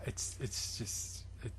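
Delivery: barely audible, words trailing; stunned near-whisper; whispered disbelief. Real, not acted. Speechless at the story, whispering.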